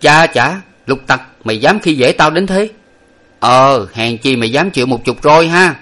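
a touch of indignant scolding; putting on sternness. A narrator's reading. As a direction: feign severity as you rebuke the servant. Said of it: "làm nghiêm nói với Lục Tặc rằng".

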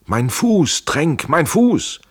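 high, loud cry of pain; drawn-out plaintive call for help; pained wail. A narrator's reading. Injured Schell calls to Trenck, pained wail. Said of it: "Da hört er es wimmern".

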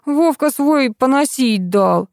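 slow, high innocent fib; feigned honesty. A narrator's reading. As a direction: lie slowly as if utterly honest. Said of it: "самым честным видом сказал лгунишка". (с притворной честностью)

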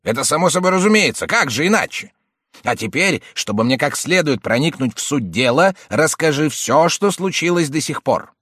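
high, loud bossy uncle. A narrator's reading. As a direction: press him like a pushy uncle. Uncle's voiced lines.

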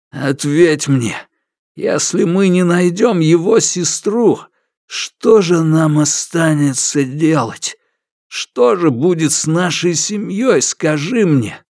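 desperate demand. A narrator's reading. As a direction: press him desperately for an answer. Father demanding answers.

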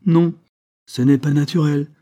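loud, high, obstinate contradiction. A narrator's reading. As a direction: dig in and repeat it firmly. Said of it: "répéta Madame Sainte-Juste avec obstination".

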